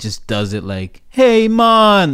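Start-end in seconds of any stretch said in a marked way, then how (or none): 1.1-2.1 high, loud caricature of a Jamaican greeting; overdone, all-volume island greeting; loud fake Jamaican. Shown as a demonstration. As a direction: belt it out like a bad Jamaican accent. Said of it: "It's all loud and".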